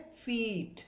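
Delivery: फीट with a long ee; long ee in feet. Shown as a demonstration. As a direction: hold the ee in फीट. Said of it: "long sound है". (लंबी ध्वनि में फीट)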